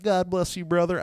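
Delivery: mock Southern preacher greeting; Southern accent. Suited pastor greeting a brother, Southern accent. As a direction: raise the pitch in a put-on Southern accent. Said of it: "they have a Southern accent".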